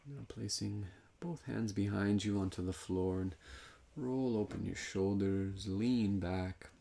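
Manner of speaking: calm, measured guidance with long pauses; guided meditation. Real, not acted. Meditation teacher guiding breath into the side body, calm guided meditation. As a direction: lead them unhurriedly through each movement, leaving room to breathe.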